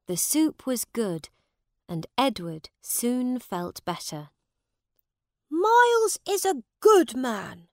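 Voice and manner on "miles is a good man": put-on high young prince voice; the boy king musing approvingly; raised, youthful royal voice; boy king thinking. A narrator's reading. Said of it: "thought Edward".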